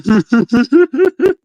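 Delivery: high, loud burst of laughter; laughing at his own mock voice. Real, not acted. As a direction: crack up loudly.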